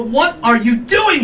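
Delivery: high, drawn-out mock outrage; incredulous demand. Man to his texting lover, incredulous. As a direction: ask it in stretched-out disbelief. Catches partner texting during sex.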